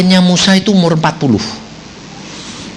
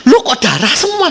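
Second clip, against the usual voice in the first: raised, startled question; mock alarm; 6 semitones higher than usual. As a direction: voice someone finding blood. Someone voiced discovering blood. (kaget pura-pura)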